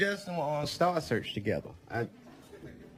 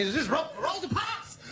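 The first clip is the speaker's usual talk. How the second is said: mock fight outburst; 11 semitones higher than usual. Acts out his fantasy of being rushed.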